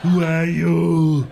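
loud, slow Austrian action-star questions; Arnold Schwarzenegger. Acted. Action star interrogates someone, Austrian-accented Schwarzenegger impression. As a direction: drag out each question in a thick Austrian accent. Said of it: "Arnold Schwarzenegger has a cool voice".

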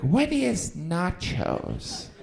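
slow, dim question; dumb customer. Retold or imitated. Clueless customer asking the waiter, slow and dim. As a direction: ask it slowly like a clueless diner. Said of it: "they'd be like".

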